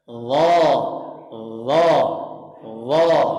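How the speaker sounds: "Allah" with a thick, full l; heavy Allah. Shown as a demonstration. Teacher modelling the heavy Allah for the student. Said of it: "Ulangi Bapak".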